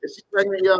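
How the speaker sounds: usual speaking voice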